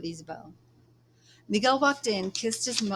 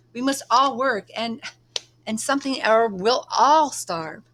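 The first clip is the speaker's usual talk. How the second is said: put-on angry young man shouting, much higher and louder; raised voice; 6 semitones higher, 8 dB louder than usual. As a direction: yell back at her in frustration. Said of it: "Miguel raised his voice".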